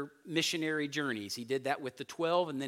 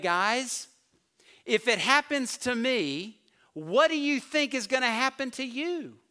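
voicing Jesus; 5 semitones higher, 6 dB louder than usual. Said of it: "he's saying".

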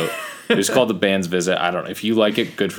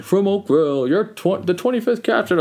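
rising, much higher excited arena announcement; announcer voice; 13 semitones higher than usual. Retold or imitated. Imitating the announcer to podcast partner, excited. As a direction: call it out like the PA announcer. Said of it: "them saying".

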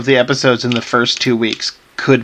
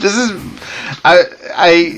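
pursed lips; 4 semitones higher than usual. Pretend. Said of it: "through pursed lips".

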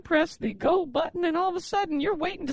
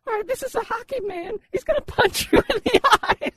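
loud, higher TV pitchman; home shopping host; 6 semitones higher, 5 dB louder than usual. Acted. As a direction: punch up the sales pitch.

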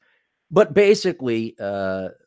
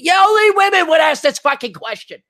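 angry rant; 12 semitones higher, 4 dB louder than usual. Real, not acted. Ranting aside shouted.

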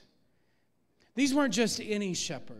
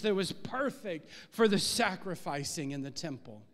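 ordinary talk

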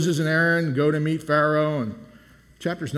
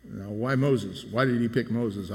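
ordinary talk, nothing marked

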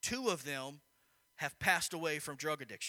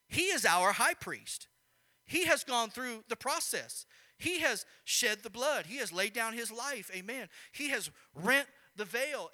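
preaching; 5 semitones higher, 5 dB louder than usual. Acted. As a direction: preach it out with full force. Pastor preaching about the high priest.

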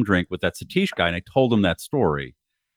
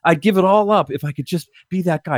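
starstruck fan; 10 semitones higher than usual. Retold or imitated. Friend gushing over actor.

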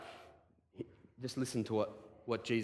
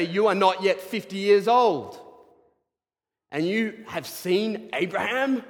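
raised, high disbelief; incredulous challenge; 5 semitones higher, 5 dB louder than usual. Acted. Pharisees doubting Jesus, high and raised. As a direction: throw the disbelief at him.

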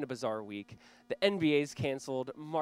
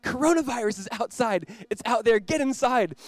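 put-on higher, urgent kid voice; imitating his son; 8 semitones higher, 9 dB louder than usual. Pastor imitating his son begging him inside, higher urgent kid voice. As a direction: play the scared little boy pulling dad indoors. Son's alarmed plea retold.

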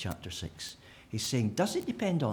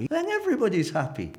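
mock glee; 11 semitones higher, 5 dB louder than usual. Mock conclusion.

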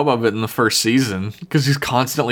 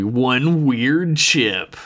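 slow, drawn-out mock trailer tagline, pitched up; eighties movie trailer; much slower than usual. Riffing on the emotion chip as an eighties movie, mock trailer voice. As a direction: stretch each word like a movie tagline. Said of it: "That sounds like an eighties movie".

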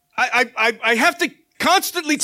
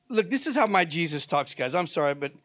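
normal talking voice

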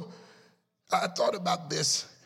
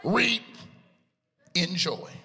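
preaching at full force; 2 semitones higher than usual. Acted. Preaching with music.

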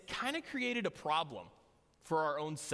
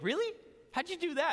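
much higher, incredulous question; surprised roommate; 10 semitones higher, faster than usual. Retold or imitated. Roommate hearing about the scratched cornea, high and incredulous. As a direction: squeak it out in disbelief.

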